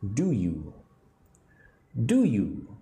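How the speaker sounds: "Do you" blended into a single word; linked "Do you", repeated for learners to copy; as one word. Shown as a demonstration. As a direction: say "Do you" joined, with no gap. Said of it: "As if it was one word".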